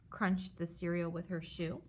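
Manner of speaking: plain reading voice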